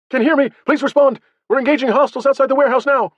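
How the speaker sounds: high, loud, rushed distress call; urgent. A narrator's reading. Soldier under fire calling for a response, urgent shouting. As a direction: fire it out urgently over the radio. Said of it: "speaking urgently".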